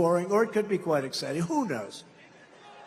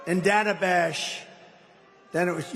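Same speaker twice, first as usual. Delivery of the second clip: ordinary talk, nothing marked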